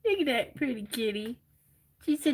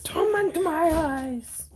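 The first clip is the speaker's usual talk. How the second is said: slow dramatic echo; 3 semitones higher, much slower than usual. Acted. Echoes slow dramatic line.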